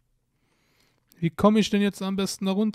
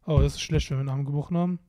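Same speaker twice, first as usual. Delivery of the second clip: normal talking voice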